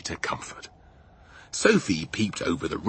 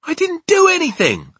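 very high, loud denial; protesting girl; 11 dB louder than usual. Acted. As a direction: protest innocence at a raised pitch. Sophie protests innocence.

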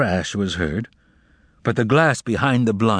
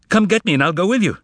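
urgent plea; 9 semitones higher, faster than usual. Acted. Ivan pleading on the phone.

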